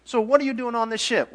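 high, loud challenge; incredulous sailor. Acted. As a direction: demand an answer from the runaway. Sailors question the fleeing prophet.

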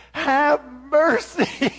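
loud, high, slow "Have mercy" wailed; begging plea. Retold or imitated. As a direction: beg for mercy like a scared kid. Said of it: "grovel and beg".

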